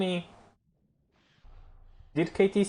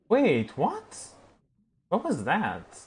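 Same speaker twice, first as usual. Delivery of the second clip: much higher, rising wait what; baffled wait what; 7 semitones higher, slower than usual. Real, not acted. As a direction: catch yourself in disbelief. Confused by what happened.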